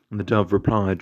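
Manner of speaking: ordinary delivery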